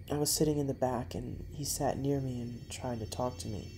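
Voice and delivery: ordinary delivery, nothing marked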